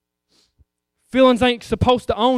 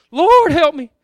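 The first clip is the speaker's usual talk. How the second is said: high, loud cry for help; panicked plea; 7 semitones higher, 9 dB louder than usual. Acted. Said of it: "they're like".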